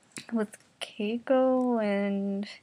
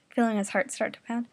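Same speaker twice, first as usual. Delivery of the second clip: ordinary delivery, nothing marked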